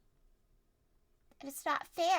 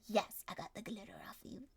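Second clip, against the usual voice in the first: whisper; 5 semitones lower, 8 dB quieter than usual. Acted. Whispered relief.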